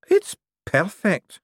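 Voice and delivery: high, slow and hushed; awed young man. Acted. Young man marveling at the forged ring. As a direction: breathe it in wonder.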